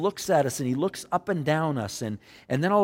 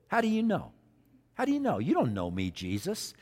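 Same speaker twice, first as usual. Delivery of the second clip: sarcastic; 4 semitones higher than usual. Acted. Nathanael answering Jesus back, sarcastic. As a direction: challenge him with mock disbelief. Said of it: "even the sarcasm in his voice".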